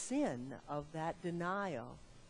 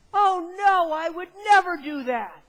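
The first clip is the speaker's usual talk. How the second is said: high, loud, indignant denial; loud protest; 12 semitones higher, 16 dB louder than usual. Acted. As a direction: shout it like Peter swearing he'd never. Peter protesting to Jesus.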